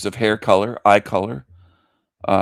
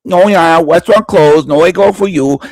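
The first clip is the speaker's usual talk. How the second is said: mock Chinese accent; 6 semitones higher, 9 dB louder than usual. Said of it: "you missed my Chinese".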